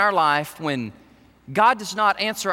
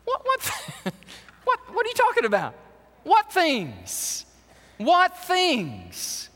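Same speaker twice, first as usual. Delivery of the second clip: much higher, quick, playfully clueless; feigned ignorance; 10 semitones higher than usual. Retold or imitated. Jesus teasing the sad disciples, mock innocent. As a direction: play dumb with a grin in the voice. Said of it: "I almost laugh when I read this".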